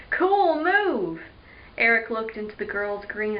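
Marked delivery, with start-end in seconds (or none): 0.1-1.2 impressed. Acted. Eric impressed by girl's trick.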